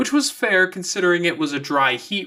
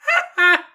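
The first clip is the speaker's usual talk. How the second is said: teasing laugh; 6 dB louder than usual. A narrator's reading. Teasing exchange between friends.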